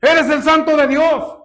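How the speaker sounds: loud, high, slowed cry; acted fearful shouting, each phrase drawn out; imitated demon's shout. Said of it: "Él empezó a gritar".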